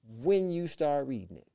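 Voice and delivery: slow, deliberate prophetic pronouncement; slow, weighty instruction. Retold or imitated. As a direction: draw the words out with weight. Quotes grandmother's prophetic instruction.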